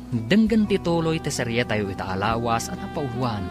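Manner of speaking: ordinary talk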